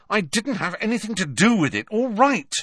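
put-on a sulky teen protesting; defensive Malfoy. A narrator's reading. Malfoy defending himself to Snape, high and touchy.

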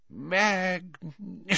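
slow, high, breathy awe; Slughorn admiring. Acted. Slughorn admires the dead spider, slow and awed. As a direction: savour the word.

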